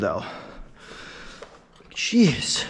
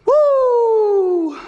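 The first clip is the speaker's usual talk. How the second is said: excited whoop; 7 dB louder than usual. Real, not acted. Psyching up before a lift.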